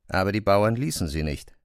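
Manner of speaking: ordinary talk, nothing marked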